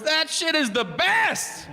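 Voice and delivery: high, drawn-out mock rapture; ecstatic raving. Driver selling his passenger on rocks, high ecstatic raving.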